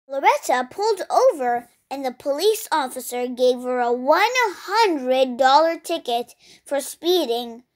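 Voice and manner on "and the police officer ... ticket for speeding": ordinary delivery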